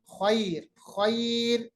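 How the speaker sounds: खैर with a soft, slowly drawn ai; soft drawn vowel. Shown as a demonstration. Teacher stretches खैर slowly for the class. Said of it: "या को जरा मुलायम आवाज के साथ पढ़ेंगे".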